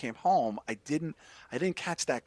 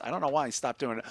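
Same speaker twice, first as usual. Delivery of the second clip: ordinary talk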